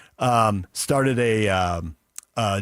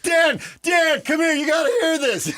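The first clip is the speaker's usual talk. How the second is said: calling out. Retold or imitated. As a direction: yell across the hall for Dan. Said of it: "You're doing this like".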